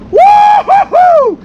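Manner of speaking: high, long, loud woo-hoo-hoo; very high, drawn-out victory whoop; whooping cheer. Real, not acted. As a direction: whoop like you just hit the jackpot. Celebrating two bucks caught.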